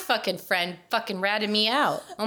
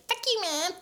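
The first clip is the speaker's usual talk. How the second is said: high-pitched, unforceful retold cussing; mock defiance; 12 semitones higher than usual. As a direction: toss off a high mock teenage insult.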